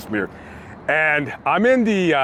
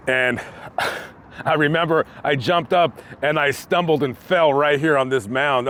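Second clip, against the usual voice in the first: usual speaking voice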